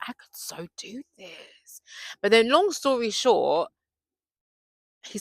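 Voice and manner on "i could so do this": whispered thought. Retold or imitated. Secret thought about training.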